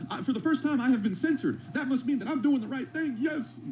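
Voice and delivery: high, gloating parody of a censored influencer; mock conspiracy guru. Reactor mocking the influencer's followers, in a high gloating voice. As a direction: play him as smug about being banned. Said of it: "this is the types of people that they watch".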